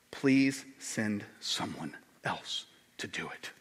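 slow, hushed low plea; begging Moses. Retold or imitated. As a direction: beg it softly, word by word. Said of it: "he just kind of basically just begs".

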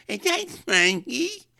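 loud, slow, very high impediment voice; imitated mother with a speech impediment; harelip mother. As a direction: shriek a drawn-out cleft-lip greeting. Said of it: "Then the mother comes in".

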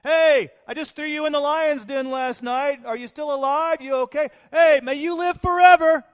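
high, loud comic bafflement; mock incredulous king. Preacher joking how Daniel greets the king politely. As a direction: ham up the surprise at the manners.

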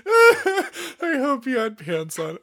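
high, slow repeat through laughter; amused quoting. Retold or imitated. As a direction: squeal it back, cracking up. Laughing repeat of mother's line.

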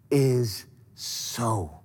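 dropping to a low whisper on angry; hushed whisper trailing off; whispered anger. Acted. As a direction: sink into a whisper to let the anger sit. Preacher dramatizes Esau's anger.

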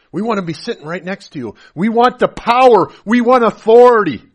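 building from brisk to loud demands, ending high; demanding mother. Retold or imitated. Mother demanding seats beside Jesus, building to loud. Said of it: "their mother came to him and said".

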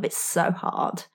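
slow, breathy, near-whispered defeat; deflated inner voice. Acted. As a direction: let it sag out like giving up. Voicing the internal slump.

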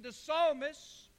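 ordinary delivery

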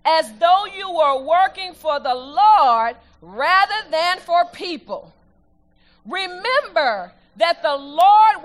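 loud, slow, stressed reading aloud; slow emphatic scripture reading. Acted. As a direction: proclaim each phrase to the congregation.

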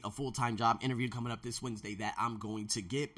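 ordinary talk, nothing marked

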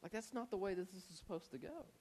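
hushed man-to-man objection; confidential aside. Retold or imitated. Peter privately correcting Jesus, quiet aside. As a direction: keep it down, as if pulled aside. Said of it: "he pulls him to the side".

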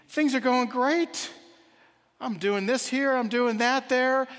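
high, loud, lilting sing-song; happy sing-song. Acted. Happy custodian answering the king, in a sing-song. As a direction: sing it out happily, drawn-out and high. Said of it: "had that sing-songiness in her voice".

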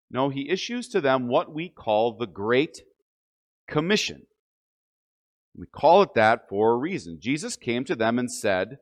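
ordinary delivery

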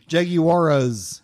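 slow "Jaguaras" with an extra uh after the R; imitated mispronunciation. Friend mocking another's error, slow mimicked pronunciation. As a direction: draw out "Jaguaras" to expose the added syllable. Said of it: "He's putting something after the R".

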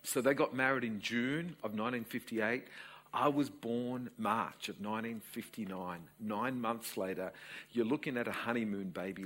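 ordinary delivery

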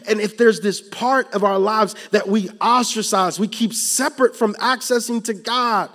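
loud, rising sermon delivery; preaching at full force. Acted. Preacher warning the congregation, loud and urgent. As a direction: press the fear home to the church.

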